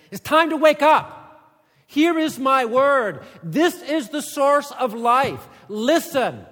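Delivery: loud, high, slow booming command; God's wake-up call. Acted. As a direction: thunder it out like God waking his people. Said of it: "God is saying".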